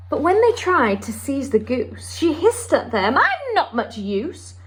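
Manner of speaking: lively storybook read-aloud with character voices; story time voice for a children's picture book. Acted. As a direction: read it to a class of little ones, bright and expressive.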